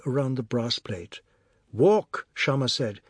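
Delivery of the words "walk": scolding. A narrator's reading. Wife scolds pacing husband.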